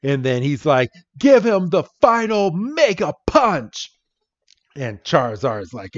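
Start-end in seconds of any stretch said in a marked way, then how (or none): 1.2-3.9 high, hammed-up anime trainer; climactic battle command. Red orders Charizard's final blow, dramatically commanding. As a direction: build it up as the finishing order.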